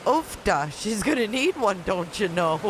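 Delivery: put-on playful, lilting Minnesota-style accent; exaggerated northern Midwest vowels, amused; mock Upper Midwest accent. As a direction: ham up the Midwestern sayings. Plays with Midwestern sayings, then laughs.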